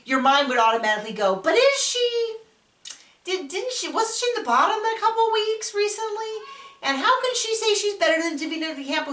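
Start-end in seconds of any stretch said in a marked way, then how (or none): 1.4-9.1 much higher, doubting mock inner voice, questioning fast; sceptical inner voice. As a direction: play the doubting voice in your head, pitched way up.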